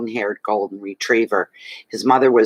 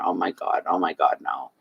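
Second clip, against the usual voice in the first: soft, fading gasp of dismay; hushed, trailing off into nothing; the quiet horror of finding him collapsed; retold dismay; 7 semitones lower, 7 dB quieter than usual. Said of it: "I went".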